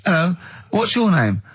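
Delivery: high, lightly voiced little neighbour, no accent; a neighbour greeting you at the door, high and chirpy. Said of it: "didn't even do the Chinese accent".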